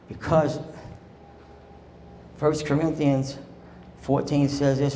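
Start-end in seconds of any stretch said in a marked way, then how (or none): none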